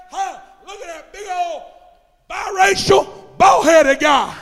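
high, loud imitation of jeering neighbours; shouted mock ridicule. Preacher imagines residents laughing at him, shouting their mock jeers. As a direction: shout it out as comic self-mockery, drawn out at the end.

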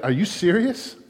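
mock disbelief, lifted pitch; a touch of mocking incredulity; incredulous. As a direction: play God's amused disbelief. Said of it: "We might say something like today, like".

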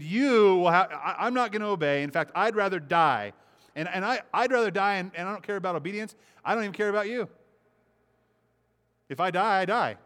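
loud, high, defiant Jonah refusing God; defiant refusal. Retold or imitated. Jonah defying God, a loud stubborn refusal. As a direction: spit it out like a stubborn rebel who'd rather die.

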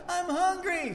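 put-on small child whining that he's hungry; little son's voice. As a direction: play the small boy asking for food. Voicing his little son.

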